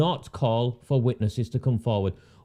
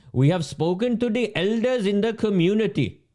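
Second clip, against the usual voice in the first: higher, mock-solemn official recital; 6 semitones higher than usual. Mocking the token official beside the policeman, higher-pitched parroting. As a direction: parrot the stock phrase in a raised voice.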